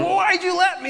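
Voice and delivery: high-pitched whiny protest; hammed-up aggrieved question; mock complaint. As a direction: protest as if unfairly let down. Acting out complaint to God.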